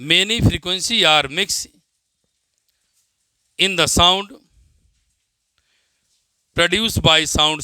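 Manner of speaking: slow, deliberate dictation pace. Acted. Teacher dictating a definition to students, slow dictation. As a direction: spell it out word by word for note-taking.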